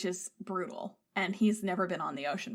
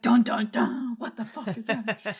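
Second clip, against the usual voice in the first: 1 mock dramatic laughing; 6 dB louder than usual. Grandpa's dramatic reveal, laughing.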